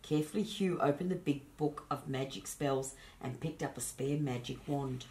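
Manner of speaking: plain reading voice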